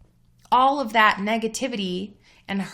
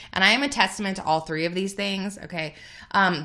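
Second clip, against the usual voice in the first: ordinary talk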